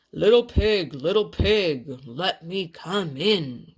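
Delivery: big bad wolf growling to be let in. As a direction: lean in and growl at the door. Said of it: "growled the wolf".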